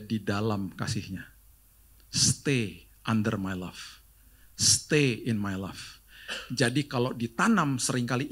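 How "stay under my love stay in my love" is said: slow tender words. Acted. God inviting the listener, very slow and tender. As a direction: draw it out tenderly.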